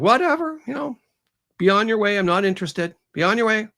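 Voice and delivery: high, sing-out brush-off; acted dismissal. Woman to glaring raven, high-pitched dismissive shooing.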